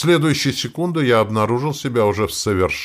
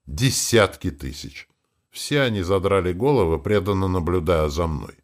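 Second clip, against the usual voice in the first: ordinary delivery, nothing marked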